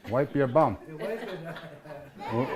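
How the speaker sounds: ordinary talk, nothing marked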